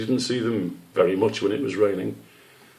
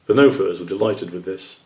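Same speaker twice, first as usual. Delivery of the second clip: usual speaking voice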